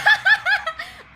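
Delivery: high, loud burst of real laughter; real belly laugh at the game. Real, not acted. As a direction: crack up helplessly.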